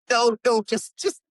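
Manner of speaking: high, loud burst of no, no, then trailing off; frantic protest. Retold or imitated. Elijah mock-frantic, waving the translation off. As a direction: blurt it out in alarm, then falter. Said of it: "He's like".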